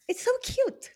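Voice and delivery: ordinary talk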